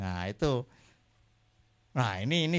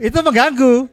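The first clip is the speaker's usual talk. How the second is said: tertawa geli; 8 semitones higher, 10 dB louder than usual. Real, not acted. Mengeluh sambil tertawa.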